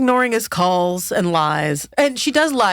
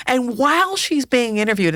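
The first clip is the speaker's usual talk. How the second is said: ordinary talk, nothing marked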